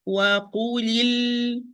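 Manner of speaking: wait on the ل closing "وقولي ال-"; "وقولي ال-" with a lingering final ل; held ل. Shown as a demonstration. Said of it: "wait a little".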